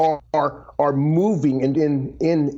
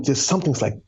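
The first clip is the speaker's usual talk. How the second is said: ordinary talk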